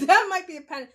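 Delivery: high, loud burst through laughter; laughing. Real, not acted. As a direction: crack up at a sudden realisation. Amused at her own sudden realisation.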